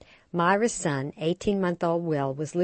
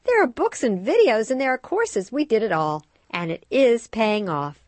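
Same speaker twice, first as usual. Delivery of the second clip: higher, louder proud mom; lightly voiced explaining friend; 6 semitones higher, 6 dB louder than usual. A narrator's reading. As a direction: mom telling her friend about baby signing. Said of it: "Myra explained".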